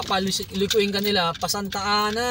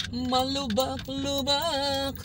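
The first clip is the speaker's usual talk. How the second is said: drawn out; 6 semitones higher, much slower than usual. Real, not acted. Stretched over bumps.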